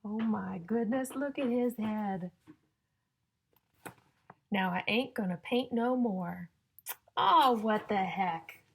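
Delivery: loud, slow, sing-song storybook reading; reading to children. Acted. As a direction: build suspense before each rhyme. Reading rhyming picture book to children.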